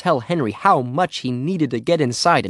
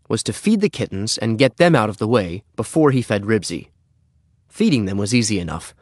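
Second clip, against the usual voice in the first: ordinary delivery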